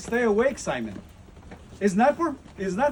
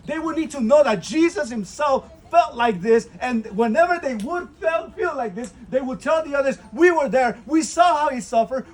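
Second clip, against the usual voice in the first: rising, louder and higher preacher's climax; preaching at full force; 6 semitones higher, 6 dB louder than usual. Acted. Preacher urging pilgrims at Gethsemane, building to a loud climax. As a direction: build it up like a revival sermon.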